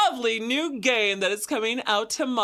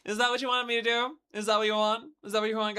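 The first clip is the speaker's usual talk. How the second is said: normal talking voice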